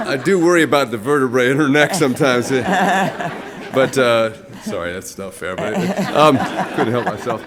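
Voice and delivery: loud, laughing tease; laughing joke. Real, not acted. Joking about his partner's height, laughing loudly. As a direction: crack up at your own jab.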